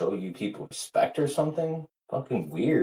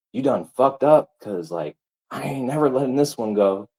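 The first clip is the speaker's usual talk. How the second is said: shouted, raised voice throughout; loud furious rant; 5 semitones higher, 6 dB louder than usual. Real, not acted. Ranting streamer shouts his fury at Abe. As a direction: yell it out at full force.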